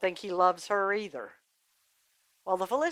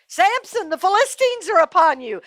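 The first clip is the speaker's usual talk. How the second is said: high, loud holler of alarm; Delilah; 10 semitones higher, 6 dB louder than usual. Acted. Delilah springing the trap on Samson, hollering. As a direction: cry it out high and loud as a warning. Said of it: "then she hollered".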